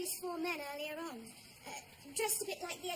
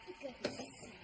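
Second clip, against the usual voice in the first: unvoiced, weary aside; whispered exasperation; 6 semitones lower, 8 dB quieter than usual. Wife sighing at husband's football yelling, whispered. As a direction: breathe it out under your breath.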